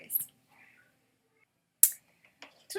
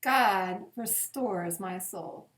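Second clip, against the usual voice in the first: slow, reverent psalm reading with long pauses; 3 semitones lower than usual. Acted. Pastor reading Psalm twenty-three at bedtime, slow and reverent. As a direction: let each verse land gently, slowly.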